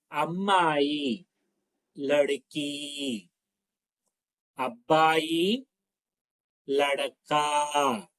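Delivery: slow and clear, a word at a time, with pauses; slow repeat-after-me. Shown as a demonstration. Teacher slowly reading a word list to repeat after her. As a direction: leave room for the class to echo each word. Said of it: "నేను స్లోగా చెప్తున్నాను".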